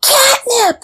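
high excited shriek. A narrator's reading. Cats shrieking at the catnip, high and excited. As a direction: squeal the word with delight. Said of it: "shrieked the".

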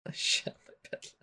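breathy unvoiced whisper; heavy breathing phone whisper. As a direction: whisper it like a creepy caller. Meme quote in odd voice.

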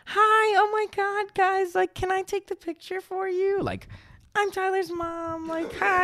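very high, gushing tipsy mom voice; very high, gushing drunk mom voice; drunk mom impression. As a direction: squeal it like an overexcited tipsy mom. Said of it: "she was drunk".

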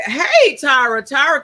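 loud call across the room. Real, not acted. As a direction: call out to her. Calling out to someone.

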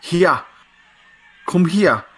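broader Geordie accent. Shown as a demonstration. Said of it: "get a little bit broader".

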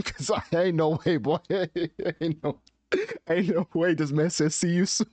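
laughing through the words; cracking up mid-sentence; stifled giggles. Real, not acted. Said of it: "I'm trying not to laugh".

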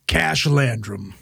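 slow, gravel-free tough-guy name drop; detective voice. Pretend. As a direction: say it like a noir detective. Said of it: "Sounds like a fucking detective".

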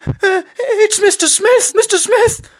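very high, loud, trembling squeak; hammed-up terrified high-pitched impostor; squeaky tremulous voice. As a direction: squeak it out like an unbroken boy's voice. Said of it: "in a really squeaky, high-pitched voice, tremulously".

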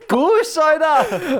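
loud shout. Real, not acted. Tells S0 to shut up.

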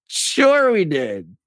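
slow, exaggerated mock agreement, pitch rising high; sarcastic. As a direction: lay the sarcasm on thick. Sarcastic answer to conspiracy question.

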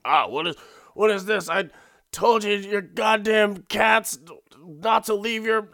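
put-on high, loud cranky old man yelling; hammed-up rage of a grumpy retiree; mock angry old man. Husband flips out at cat toy.

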